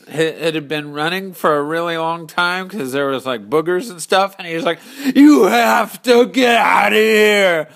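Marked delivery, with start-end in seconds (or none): 5.1-7.7 loud, high, slow desperate shout; frantic warning. Acted. Runny-nosed man yells at Courage, terrified. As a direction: drag out the warning in terror.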